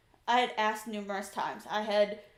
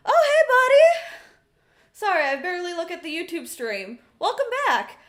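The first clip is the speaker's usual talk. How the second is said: much louder and higher, thrilled hello; excited greeting; 7 semitones higher, 10 dB louder than usual. Real, not acted. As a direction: greet a returning viewer with delight. Greeting a viewer on stream.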